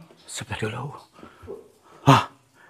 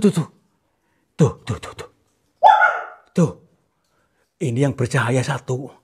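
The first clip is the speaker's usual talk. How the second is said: usual speaking voice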